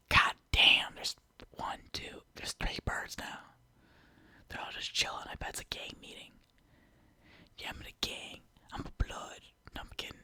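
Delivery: hushed whisper so the neighbors don't hear. Real, not acted. Hearing the neighbors' door, whispering to viewers. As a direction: drop to a secret whisper. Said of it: "I'ma get really quiet and close here".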